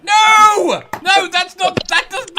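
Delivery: sharp, loud "no!" cutting in; loud protest. Real, not acted. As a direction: shout the objection down. Protests a chat question loudly.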